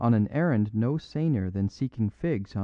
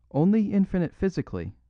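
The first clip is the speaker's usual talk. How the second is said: a higher-voiced little priest cutting in; little priest's retort; 8 semitones higher, faster than usual. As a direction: jump in higher as the small priest. Little priest interrupts in the argument.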